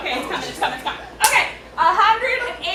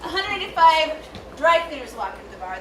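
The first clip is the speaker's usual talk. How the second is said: ordinary delivery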